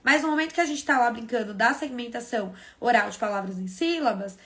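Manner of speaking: ordinary talk, nothing marked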